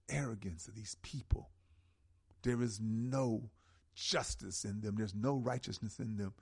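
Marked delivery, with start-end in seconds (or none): none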